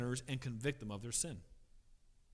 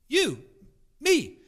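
emphatic answer; 12 semitones higher, 10 dB louder than usual. Real, not acted. Preacher answers his own question.

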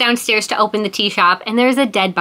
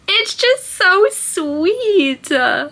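very slow, much higher, crying through a laugh; 11 semitones higher, much slower than usual. Real, not acted. Vlogger crying over a sweet book, voice breaking. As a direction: voice breaking into tears. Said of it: "I was tearing up".

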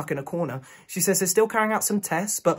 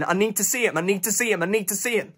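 higher, urgent, repeated pleading; desperate plea; 4 semitones higher than usual. Acted. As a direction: plead as if nothing else matters. Desperate plea to see boyfriend.

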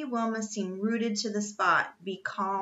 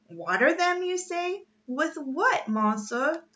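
high, incredulous Frenchman; raised-pitch exasperated questions; exclaiming; 8 semitones higher, 4 dB louder than usual. A narrator's reading. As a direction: throw it back in French-accented disbelief. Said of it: "exclaimed the Frenchman".

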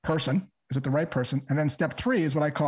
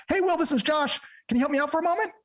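much higher, pushy salesy lilt; over-eager pitch; 11 semitones higher than usual. Shown as a demonstration. Cold caller showing the wrong, over-eager high tone. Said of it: "a tonality that's not".

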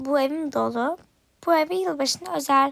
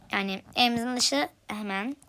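ordinary talk

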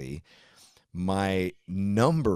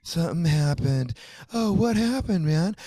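high, whiny mock plea for sympathy; mocking attention-seeker; 9 semitones higher, 3 dB louder than usual. Mocking the attention-seekers he can't stand, in a high whiny voice. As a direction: parody a friend fishing for pity online. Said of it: "this little cryptic fucking".

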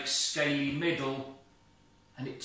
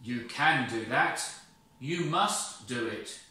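loud, firm insistence; firm God; 5 dB louder than usual. Acted. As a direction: insist with authority.

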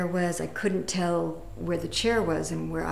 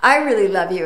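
louder joyful declaration; 10 dB louder than usual. Retold or imitated. Her reply to the garage guy.

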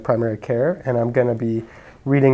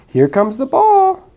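high, loud eager throw call; excited call; 14 semitones higher, 9 dB louder than usual. Acted. Piggy excitedly announcing the throw.

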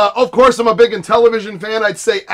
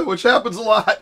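ordinary talk

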